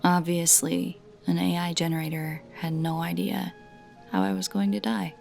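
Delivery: ordinary delivery, nothing marked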